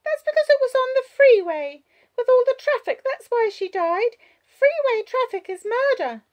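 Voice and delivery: put-on high, loud little-girl voice; child explaining. A narrator's reading. Child explaining how mother died, high loud child voice. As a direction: explain emphatically as a small child.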